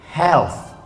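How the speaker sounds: "Health" ending in a clear th; th sound. Shown as a demonstration. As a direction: bite the th in "Health". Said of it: "huruf tha".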